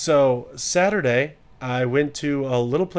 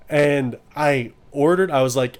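ordinary talk, nothing marked

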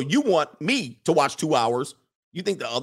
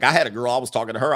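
ordinary talk

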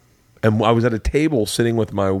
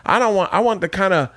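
high-pitched comic voice; hammed-up high comedian voice; Ralphie May bit; 8 semitones higher than usual. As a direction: pitch up and riff the joke. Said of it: "he would say things".

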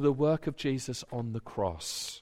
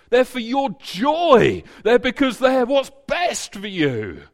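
higher, louder full-force preaching; preaching shout; 6 semitones higher, 7 dB louder than usual. Real, not acted. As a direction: drive the point home. Preacher's climax, shout heard.

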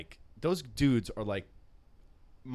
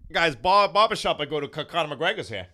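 mock bragging; 5 semitones higher, 7 dB louder than usual. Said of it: "So I'm like, you know".

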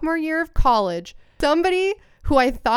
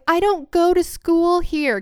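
put-on crying girl; 7 semitones higher, slower than usual.

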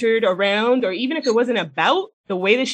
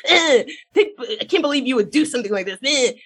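high, disgusted mock whine; bitchy whine; 5 semitones higher than usual. Host mocking Carrie scolding Miranda, high whiny disgust. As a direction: sneer out the blame in a whiny voice. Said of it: "keeps being a fucking bitch".

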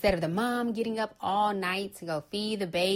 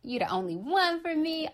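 mock sweet talk; 7 semitones higher than usual. Man sweet-talking his girlfriend, mock syrupy. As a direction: ham up a smooth-talking man's promises. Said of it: "They can say".

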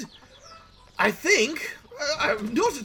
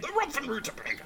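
softer hushed reveal of the Ruffin Rutabaga; hushed build-up; 6 semitones higher, 8 dB quieter, faster than usual. Acted. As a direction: breathe out the Ruffin Rutabaga in awe. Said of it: "dare I say it".

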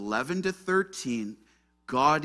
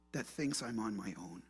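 hushed prayer; 4 dB quieter than usual. Acted. Preacher voices a simple prayer to God, hushed prayer. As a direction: pray it gently, leaving space between phrases.

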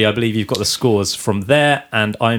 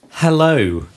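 campy drag queen; 6 semitones higher than usual. Pretend. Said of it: "That's not an appropriate voice for Hereditary".

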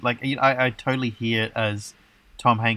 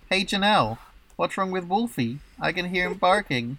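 higher voiced worried question; quoted movie line; 7 semitones higher than usual. Host reads the week's quote in a lightly higher voice. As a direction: lightly act out a worried neighbour calling over. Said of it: "that quote, once again, is".

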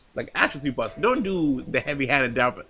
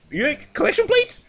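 incredulous; 5 dB louder than usual. Acted. Answering a friend who doubts church costs money, high incredulous squawk. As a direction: voice leaping very high and louder. Disbelief at not knowing collection plate.

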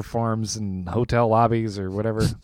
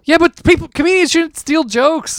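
indignant; 5 dB louder than usual. Real, not acted. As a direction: insist in outrage. Indignant about joke stealing.

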